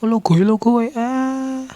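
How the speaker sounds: mock a village Javanese saying lo gue; playful Javanese medok impression; Javanese medok accent. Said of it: "orang Jawa nih ngomong nih".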